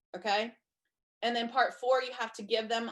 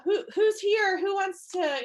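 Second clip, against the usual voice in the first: higher, louder hype host voice; excited host callout; 9 semitones higher, 6 dB louder than usual. Pretend. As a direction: call out to the crowd, higher and louder. Acting a party host calling out.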